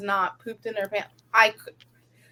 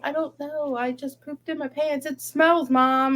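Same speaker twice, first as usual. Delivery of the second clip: lightly voiced child, pitched higher; imitating young daughter; 5 semitones higher than usual. Daughter explains an accident to mom, lightly imitated child voice. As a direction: raise the pitch like a kid explaining herself. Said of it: "She's like".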